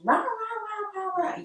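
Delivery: imitated yelling rahs, one shooting up high; a hammed-up angry rant with no words; mock angry rahs. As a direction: playfully growl rah rah at him. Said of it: "yelling at him".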